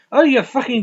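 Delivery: high, loud mock South African; bad South African accent. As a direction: shout abuse in a botched South African accent. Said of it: "I can't do a fucking South African".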